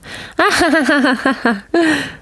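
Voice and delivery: high, giggling laugh; girl laughing on her back in the snow; girl's delighted laugh. A narrator's reading. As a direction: laugh at your own tumble.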